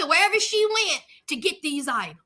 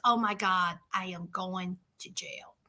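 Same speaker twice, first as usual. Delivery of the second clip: whispered, low and much softer; 10 semitones lower, 10 dB quieter than usual. Retold or imitated. Ayana pulling in at the jail, whispering in panic. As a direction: breathe it out in dread. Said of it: "She says".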